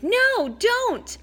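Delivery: high loud screamed warning. A narrator's reading. Girl screaming at Percy to stop. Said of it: "Annabeth screamed".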